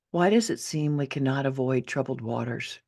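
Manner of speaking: ordinary delivery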